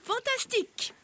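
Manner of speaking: high thrilled exclamation; delighted. Acted. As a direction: burst out with joy. Colonel ravi de l'idée.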